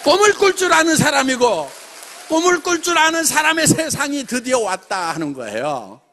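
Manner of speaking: loud and high, a rousing sermon-style pitch; rally the room like a revival preacher; preaching at full force to the crowd; fired-up preaching. Acted. Climax of motivational speech.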